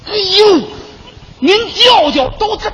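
a sudden loud cry of surprise; loud outburst. Acted. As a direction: blurt it out high and loud. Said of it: "嚷什么你？".

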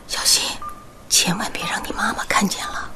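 whisper. Acted. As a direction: whisper urgently to hide it. Hiding from sleeping mother.

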